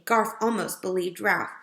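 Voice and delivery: usual speaking voice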